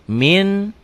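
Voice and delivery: MIN with a clear n, as spelled; written form. Shown as a demonstration. Teacher showing pupils the written spelling, clear n. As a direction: say MIN ending on a plain N. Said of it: "dibacanya jadi ming".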